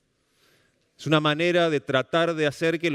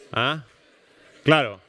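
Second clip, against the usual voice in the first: ordinary talk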